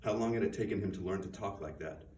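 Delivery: ordinary delivery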